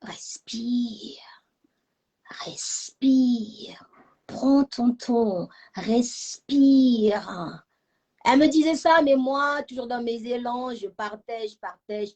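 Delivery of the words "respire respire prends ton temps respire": breathy, drawn-out coaching; slow it right down, breathe out each word; imitating a calming mother. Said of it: "ma mère me disait toujours".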